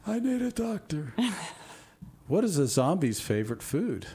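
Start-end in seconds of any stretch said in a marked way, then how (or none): 0.1-1.1 squeaky cartoon plea, much higher; high silly voice. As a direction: play it as a silly high whine. Jokey cry after doctor joke.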